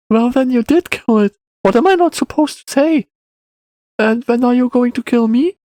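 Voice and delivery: put-on high-pitched questioning boy; pressing child. A narrator's reading. Boy asks when he'll be killed, high child voice. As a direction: challenge him in a high kid voice.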